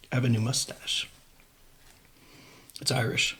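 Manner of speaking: unvoiced secret whisper. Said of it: "It has an accent".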